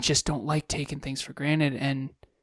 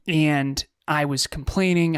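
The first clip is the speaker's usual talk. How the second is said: ordinary talk, nothing marked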